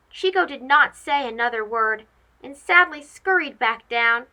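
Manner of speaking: usual speaking voice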